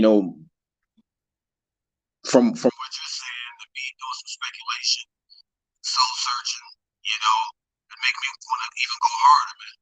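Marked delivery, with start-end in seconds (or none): 2.3-9.8 faint, muffled mic sound; talking through a bad muffled mic. Real, not acted. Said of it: "it's muffled".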